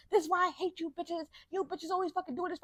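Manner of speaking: hammed-up comment rant; mock angry commenter. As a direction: mimic the outraged stranger.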